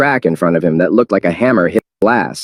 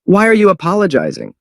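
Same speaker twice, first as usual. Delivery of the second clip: high puzzled question; puzzled disbelief; high bewildered voice; surprised; 11 semitones higher than usual. Acted. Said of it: "he was surprised".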